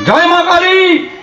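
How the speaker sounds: high, drawn-out acclamation; triumphant devotional cry. Retold or imitated. Preacher voicing devotee snatching the goat away, high drawn-out cry. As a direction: sing out the goddess's name in acted triumph.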